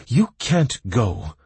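slow, haughty refusal; Aliella arrogant. A narrator's reading. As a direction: look down on them. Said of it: "arrogantly said Aliella Cedric".

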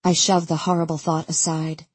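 ordinary delivery, nothing marked

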